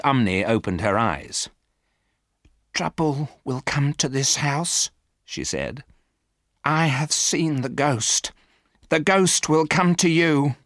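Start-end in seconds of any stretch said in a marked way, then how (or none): 2.7-10.5 lightly voiced old housekeeper, higher and slow with dread; a hint of a frightened servant prophesying; ominous housekeeper. A narrator's reading. Housekeeper warns the family of the ghost, slow and uneasy.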